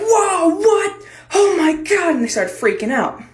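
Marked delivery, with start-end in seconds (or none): 0.0-2.2 high, hammed-up shock of a crowd; shocked class. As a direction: imitate classmates gasping in alarm. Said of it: "entire class was like".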